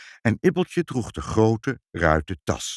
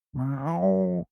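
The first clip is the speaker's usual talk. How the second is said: high, drawn-out meow; cat meowing; 10 semitones higher than usual. A narrator's reading. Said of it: "zei Moortje". (hoog, langgerekt miauwen)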